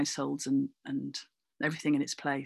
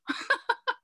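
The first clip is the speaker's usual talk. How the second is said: short laugh. Real, not acted. Laughs at own punchline.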